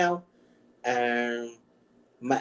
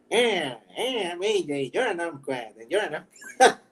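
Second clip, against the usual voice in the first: higher, swooping whiny caricature; the Phantom's impression; 3 semitones higher than usual. Retelling an impression of himself, higher whiny caricature. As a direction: imitate someone mocking you, whiny and swooping. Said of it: "did the impression of me".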